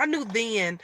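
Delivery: ordinary delivery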